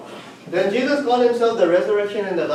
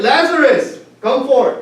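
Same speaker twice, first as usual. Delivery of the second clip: shouted command, higher and louder; loud commanding call; 5 semitones higher, 6 dB louder, slower than usual. Retold or imitated. Jesus commanding Lazarus out of the tomb, loud shout. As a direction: bellow it into the graveyard. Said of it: "He said".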